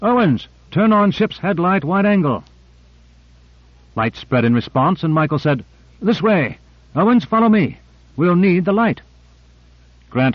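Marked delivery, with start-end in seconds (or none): 0.0-2.5 higher-pitched shouted command, little extra volume; restrained urgent shout; shouted order. A narrator's reading. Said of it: "then shouted". 6.0-9.0 high-pitched urgent commands; raised, directing voice; calling orders. A narrator's reading. Leader calling crew to follow.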